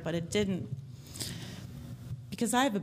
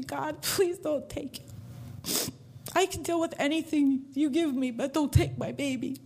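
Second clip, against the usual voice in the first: desperate prayer; 5 semitones higher than usual. Retold or imitated. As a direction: beg God to spare the child, voice lifted. Desperate prayer for her son.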